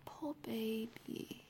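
slow teasing whisper; mock sympathy. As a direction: coo it, mocking.

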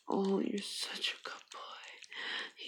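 tender whisper. Acted. Girlfriend comforting her partner, tender whisper. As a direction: soothe him in a whisper. Intimate roleplay.

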